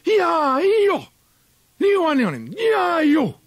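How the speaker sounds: high, loud screeching hunt cry; shrieking yarring halloo; screeching yell. Acted. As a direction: yell it out like a whip calling the pack. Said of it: "the screeching, yarring yell".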